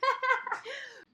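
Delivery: high burst of laughter; laughing. Real, not acted. Speaker amused at own villainess impression, high laughter. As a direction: laugh out loud after the joke.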